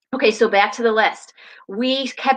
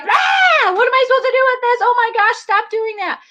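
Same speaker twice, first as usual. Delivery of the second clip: exasperated parent; 14 semitones higher, 4 dB louder than usual. Retold or imitated. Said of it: "you're like".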